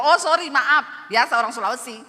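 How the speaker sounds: wife apologizes loudly to husband; loud apology. Retold or imitated. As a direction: apologize loudly without lowering the voice. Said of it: "Saya bilang".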